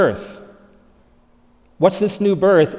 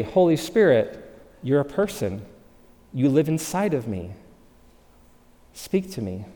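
softer and softer, down to a hushed plea; quiet prayer; 3 semitones lower, 6 dB quieter than usual. Shown as a demonstration. Preacher showing how he prays in stillness, hushed. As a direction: pray it intimately, fading to nothing.

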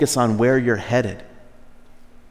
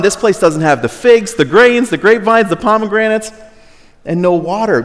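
listing complaints; 7 semitones higher, 4 dB louder than usual. Retold or imitated. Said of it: "they go on and on".

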